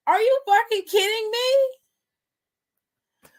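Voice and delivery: very high, slow, stretched disbelief; drawn-out disbelief. Retold or imitated. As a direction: stretch every word up high in outrage. Climax of retold rant.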